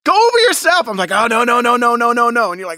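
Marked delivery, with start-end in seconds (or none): 1.1-2.5 high, loud mock panic; panicked no. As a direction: squeal out the refusals. Mock panicked refusal retold.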